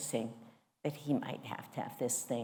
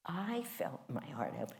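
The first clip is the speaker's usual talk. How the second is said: ordinary talk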